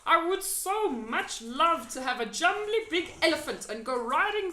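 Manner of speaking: a gentle giant wishing out loud; BFG voice. A narrator's reading. The giant telling Sophie his wish, eagerly.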